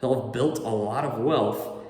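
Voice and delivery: ordinary delivery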